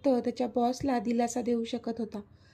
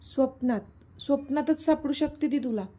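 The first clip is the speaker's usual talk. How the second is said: ordinary delivery, nothing marked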